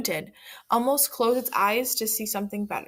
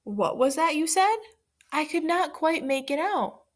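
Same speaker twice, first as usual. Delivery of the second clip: sly fox pretending; 6 semitones higher than usual. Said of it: "He just sat there and said".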